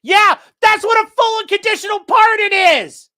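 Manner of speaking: loud, high angry shout; real angry shouting; furious rant. Real, not acted. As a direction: yell it at the judge. Ranting at judge on stream.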